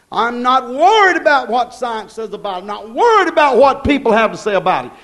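normal talking voice

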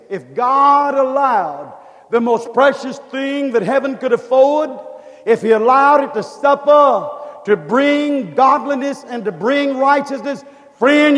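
usual speaking voice

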